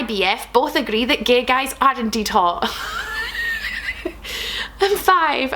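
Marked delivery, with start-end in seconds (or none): 2.7-4.8 very high, breathy shriek of laughter. Real, not acted. Amused by a fan comment, high shrieking laugh. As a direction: let out a squealing cackle.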